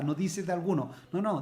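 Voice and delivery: ordinary talk, nothing marked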